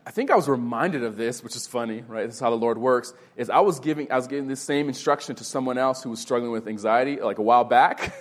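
usual speaking voice

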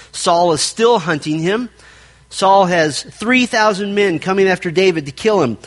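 ordinary talk

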